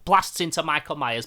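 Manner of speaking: ordinary talk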